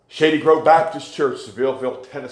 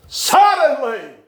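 suddenly drawn out high and slow; suddenly cried out high and slow; slow ringing suddenly; 9 semitones higher, 3 dB louder, slower than usual. Acted. Same word then cried out slowly.

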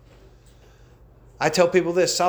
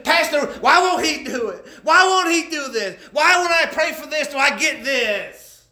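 raised-pitch mock whine, slowing on the last words; whining sinner; 10 semitones higher, 4 dB louder than usual. Preacher imitates sinner whining to the pastor. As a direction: mimic a sinner pleading with the pastor. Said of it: "why the crying and whining?".

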